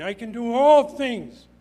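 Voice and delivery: loud, high, drawn-out proclamation; emphatic scripture declaration. Real, not acted. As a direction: proclaim the verse slowly at full voice.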